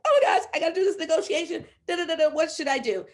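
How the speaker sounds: fast, loud, high, laughing; frantic call to her coach; panicked excitement. Retold or imitated. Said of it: "I'm like".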